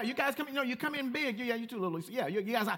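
usual speaking voice

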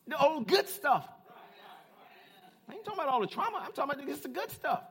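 high, shouted preacher's delivery; full-force preaching. Real, not acted. Preacher rousing church about God's new thing, full-force preaching. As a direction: drive it home at the top of your voice, punching key words.

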